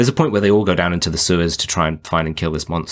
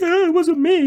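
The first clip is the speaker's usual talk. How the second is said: much higher put-on voice of a shifty kid; mock innocent denial, way up high; guilty kid voice. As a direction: squeak it out like a caught kid. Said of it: "the kid's like".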